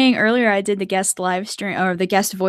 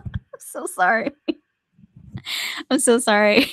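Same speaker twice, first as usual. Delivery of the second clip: laughing apology. Real, not acted. Apologising to viewers over the toy, real laughing apology. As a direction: say sorry through real giggles.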